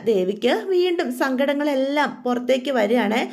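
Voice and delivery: plain reading voice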